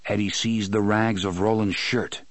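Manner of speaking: usual speaking voice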